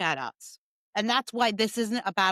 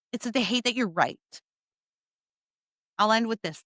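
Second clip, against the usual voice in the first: ordinary delivery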